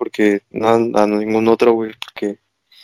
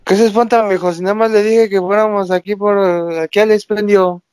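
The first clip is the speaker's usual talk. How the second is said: much higher, put-on Mexico City voice; chilango version; 8 semitones higher than usual. Shown as a demonstration. Interpreting the regio phrase in a hammed-up chilango voice. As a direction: translate it like an old chilango neighbour. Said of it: "tú vas a interpretar a lo chilango".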